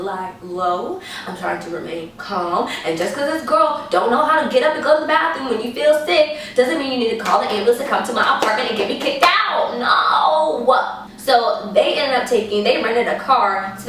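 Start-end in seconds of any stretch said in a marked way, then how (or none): 2.8-11.0 exasperated rant. Real, not acted. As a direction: rant it out, rising to a cry of no. Rant about ambulance idea.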